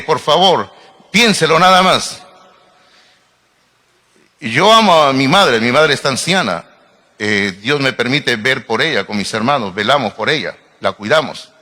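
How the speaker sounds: ordinary talk, nothing marked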